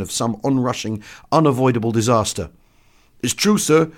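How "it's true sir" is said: high, loud soldier's voice; enlisted man insisting. Acted. Enlisted man assuring the doctor, high and loud. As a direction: insist loudly, pitched high.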